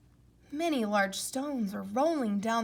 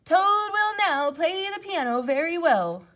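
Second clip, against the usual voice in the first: announcer voice; 7 semitones higher, 9 dB louder than usual. Acted. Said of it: "said the strange voice".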